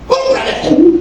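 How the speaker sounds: put-on high, pleading little kid; whiny child. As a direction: beg like a spoiled kid. Spoiled child begs indulgent dad. (niño consentido suplicando, agudo)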